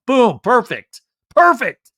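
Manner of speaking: loud, high punchy exclamations; emphatic approval. Real, not acted. Host cheering the husband's apology, emphatic approval.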